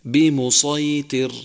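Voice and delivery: بمسيطر with the ط made light like ت; wrong light ta. Shown as a demonstration. Teacher showing the mistake of a light ط. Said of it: "ومن الخطأ أن تقول".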